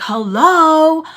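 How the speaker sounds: loud, high, drawn-out hello; indignant hello. Retold or imitated. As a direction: call him out, incredulous. Retold indignant calling out to him.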